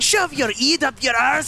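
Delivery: shouted Scottish-Irish insult; Scottish-Irish accent. Acted. Co-caster joins in with a shouted Scottish-Irish insult. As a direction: bark it with a thick Scottish brogue. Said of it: "A mix of Scottish and Irish".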